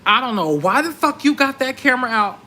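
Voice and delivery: high, loud mock of her yelling; imitating angry woman. Retelling the woman who hated the camera, mocking her loud yell. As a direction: throw her hostile line back in a shrill-ish shout. Voicing the rude woman filming.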